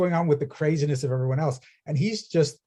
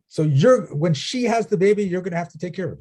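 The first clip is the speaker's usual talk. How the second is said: stern demand; 6 semitones higher, 5 dB louder than usual. Retold or imitated. Parents ordering the monk.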